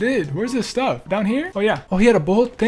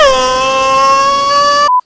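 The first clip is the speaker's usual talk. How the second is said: high laugh; 5 dB louder than usual. Real, not acted. Laughing about hot room.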